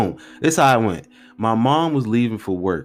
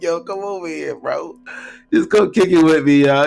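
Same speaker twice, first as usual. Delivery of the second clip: higher, louder, laughing-edged casual plea; nervous casual plea; 9 semitones higher, 6 dB louder than usual. Retold or imitated. Scared teen on the phone urging Mike to come over, nervous and higher. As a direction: play it cool while clearly scared. Calling friend because scared.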